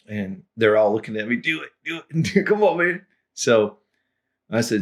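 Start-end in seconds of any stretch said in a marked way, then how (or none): 1.4-3.0 higher, eager chant of encouragement; egging on. Retold or imitated. Friends at the table urging him to order in Spanish. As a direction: hype your buddy up.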